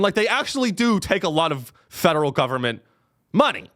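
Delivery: ordinary talk, nothing marked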